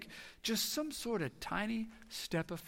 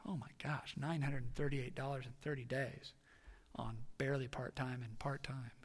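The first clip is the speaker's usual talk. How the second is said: stunned hush; 4 semitones lower, 10 dB quieter than usual. Retold or imitated. Stunned at the bill.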